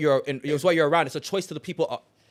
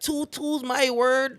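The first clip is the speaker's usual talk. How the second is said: very high, very slow mock Toronto slang; mocking the cringey online accent, squeaky high; cringe high pitch; 10 semitones higher, much slower than usual. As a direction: stretch out two two's my word up high, mocking it. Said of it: "It's too high pitched".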